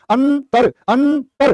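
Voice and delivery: अंतर said high and slow and hammered home; emphatic demo. Shown as a demonstration. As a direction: slowly stress अंतर to show that the रे belongs after त. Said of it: "तो रे की कहां जरूरत है".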